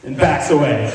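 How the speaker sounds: ordinary talk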